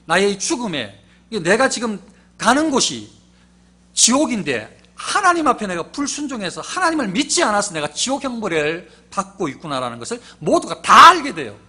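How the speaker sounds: fiery sermon climax; full-force preaching. Acted. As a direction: preach it hard to the pews. Preacher raises voice on death.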